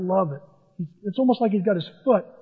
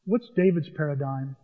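ordinary delivery

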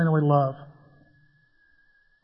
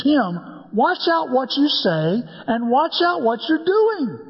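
family warning; 7 semitones higher, slower than usual. Retold or imitated. Family warning him at dinner.

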